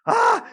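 sudden high yelp of alarm; startled yelp. Retold or imitated. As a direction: yelp like a driver cut off. Startled yelp in traffic story.

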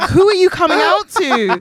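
high-pitched exasperated question; comically outraged demand; incredulous. Acted. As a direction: challenge them in comic disbelief, voice way up. Mock-challenging the couple.

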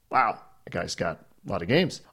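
ordinary talk

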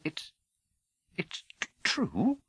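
soft stammer rising to a high last word; halting, barely got out; faltering. A narrator's reading. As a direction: stumble towards the awful question. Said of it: "faltered Professor McGonagall".

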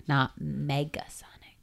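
whisper. Real, not acted. Whispered aside.

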